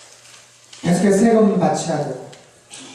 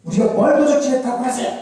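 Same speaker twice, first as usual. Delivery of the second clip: higher-pitched, indignant protest; defiant retort; 5 semitones higher than usual. Acted. Israel talking back when accused of robbery, indignant. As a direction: deny the charge with a challenging question. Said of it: "대들어요".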